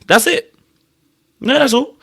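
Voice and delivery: high-pitched mock protest; acted insistence. Imagined Jonathan explaining his ex-wife situation, mock insistent. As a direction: jump up high, insisting as him.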